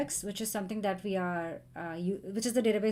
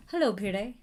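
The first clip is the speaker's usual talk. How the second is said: ordinary talk, nothing marked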